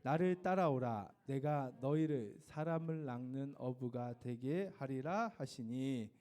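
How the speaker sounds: slow, measured choral recitation. Shown as a demonstration. Teacher reciting the memory verse with the class, slowly. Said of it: "시작".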